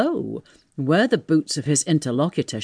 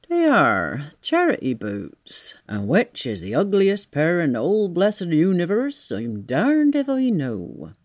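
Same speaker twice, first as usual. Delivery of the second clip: grumbling tramp. Acted. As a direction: grumble like a put-out vagrant. Voices Marvel grumbling about boots.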